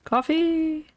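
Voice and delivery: high happy exclamation; excited find. Real, not acted. Gamer finds coffee in a locker, happy cheer.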